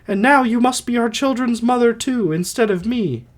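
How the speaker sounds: high put-on wife's voice; Alcestis voice. Dying wife entrusting motherhood to her husband, high put-on voice. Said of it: "I'll try to do voices".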